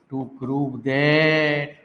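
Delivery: drawn out, word by word; slow dictation. Shown as a demonstration. Teacher dictating the proof line, slow. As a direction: let them write each word. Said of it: "तो चलिए लिखिए प्यारे".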